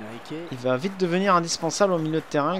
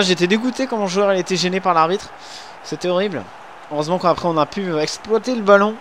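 ordinary delivery

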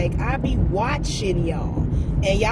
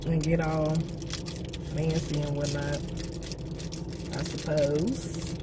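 softer, drained headache voice; softer, weary vlog update; tired with a headache; 8 dB quieter than usual. Real, not acted. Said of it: "the biggest headache known to mankind".

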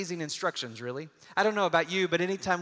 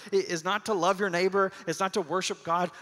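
usual speaking voice

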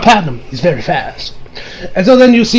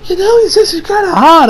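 put-on much higher, dreamy girl voice; swooning girls; 11 semitones higher than usual. Girls suddenly crushing on rude Jotaro, high dreamy voice. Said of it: "they're like".

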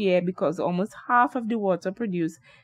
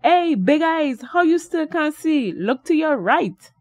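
higher and louder, teasing; Harry calling to his friend, higher and louder; exclaiming; 7 semitones higher, 5 dB louder than usual. Acted. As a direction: call out to him, pointing him over. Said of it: "exclaimed Harry".

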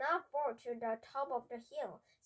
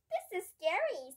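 put-on high-pitched, mildly alarmed child; worried, raised-pitch character line; scared child; 7 semitones higher than usual. A narrator's reading. Frightened child before bubble ride.

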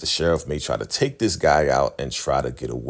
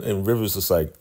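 normal talking voice

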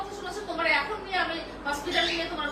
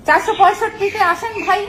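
loud, urgent shout; real, raised-voice summons mid-quarrel; urgent call for help; 3 semitones higher, 15 dB louder than usual. Real, not acted. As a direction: shout for someone to come right away. Calling for help during quarrel.

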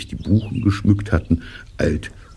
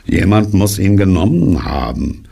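raised, louder droning complaint; higher, indignant donkey lament; aggrieved donkey; 4 semitones higher, 5 dB louder than usual. Acted. Donkey accuses someone of theft.

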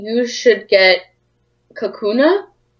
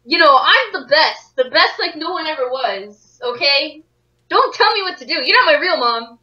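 hammed-up bragging tantrum; mock indignant; 3 semitones higher than usual. Champion trainer tells off co-player, mock indignant. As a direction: protest like a petulant child, playfully.

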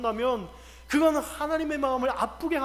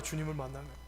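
normal talking voice

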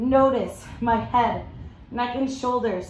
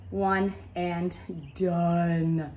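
slow drawn out; 5 semitones lower, much slower than usual. Real, not acted. End of countdown.

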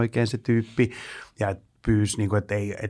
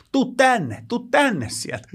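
much higher mock call from the dark; drunk heckler calling; 12 semitones higher, much slower than usual. (huudahtava humalainen, paljon korkeammalta)

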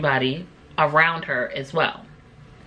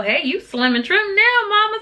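put-on much higher, playful hyped-up girl voice; cheering daughters; 13 semitones higher than usual. Daughters praising mom's new body, high playful voice. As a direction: tease mom with a high sing-song compliment. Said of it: "letting her know".

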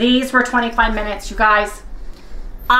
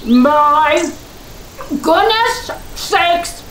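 high, loud, slow delight; ecstatic; 9 semitones higher, 4 dB louder, much slower than usual. Real, not acted. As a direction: squeal with joy over bagels. First bite, ecstatic reaction.

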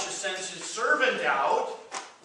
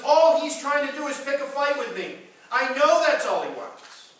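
louder, higher, indignant king; a hint of a panicked king; 8 semitones higher, 7 dB louder than usual. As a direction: voice the king's outrage at the letter. King voiced in retold story.

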